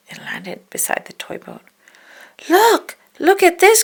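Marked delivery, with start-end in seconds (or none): 2.5-3.8 high, loud excited calling; excited cry. A narrator's reading. Dale calling Chip to the fly, high and excited. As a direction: show off the floating nut. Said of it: "cried Dale".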